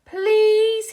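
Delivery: high, loud begging plea; pleading Patrick. A narrator's reading. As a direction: beg for it like an eager kid. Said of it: "he pleaded".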